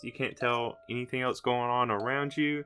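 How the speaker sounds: ordinary talk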